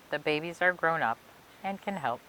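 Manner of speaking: ordinary delivery, nothing marked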